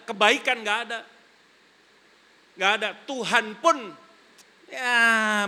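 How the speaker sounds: loud, high preaching cry; preaching at full force. Acted. Preacher crying out to the congregation, loud and high.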